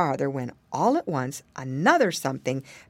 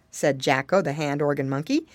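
usual speaking voice

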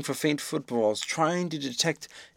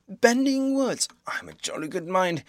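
indignant; 6 semitones higher than usual. A narrator's reading. Marvel offended by the sailor, high indignant outrage. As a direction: bristle at the insult, drawing it out.

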